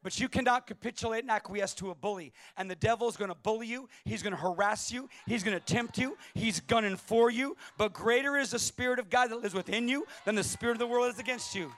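booming pulpit voice, slowing at the climax; preaching at full force. Acted. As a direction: thunder it out to the congregation.

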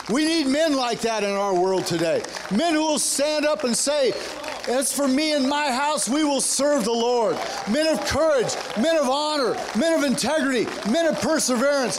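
high, driving preacher cadence; rally the congregation with rising fervor; impassioned call, stretching the key phrases; rousing preaching. Real, not acted. Preacher rallies the men.

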